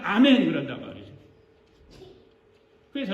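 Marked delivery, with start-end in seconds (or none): 0.0-0.4 loud, eager imitation of a congregation's amen; mock-zealous shouted response; instant amen. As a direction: fire the amen back forcefully. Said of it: "까가 떨어지자마자".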